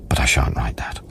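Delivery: breathy hushed whisper, pitched low; a whispered confession he won't commit to paper; whispered aside. Acted. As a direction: drop to a secret whisper as if confessing. Private aside in the diary.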